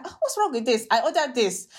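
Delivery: direct, no-nonsense "what's wrong with this"; imitating a blunt complaint. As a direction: imitate the mum just saying it straight. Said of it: "But she'll be like".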